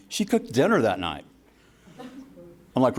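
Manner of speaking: ordinary talk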